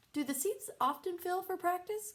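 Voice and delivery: high, prim mom voice; fussy mother. Acted. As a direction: ask sweetly. Bitsy asking.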